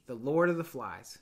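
normal talking voice

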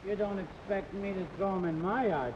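ordinary talk

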